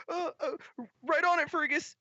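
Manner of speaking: very high, eager squeak; flustered young helper. Acted. Helper obeys Fergus, flustered high voice. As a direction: stammer out a high-pitched yes. Skinny boy answers his boss.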